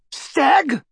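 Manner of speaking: loud, high incredulous roar. A narrator's reading. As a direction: bellow the word back at him in disbelief. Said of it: "roared the barman".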